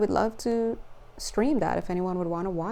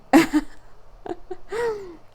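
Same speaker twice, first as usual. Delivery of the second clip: high delighted laughter; 10 semitones higher than usual. Real, not acted. Laughing at the card.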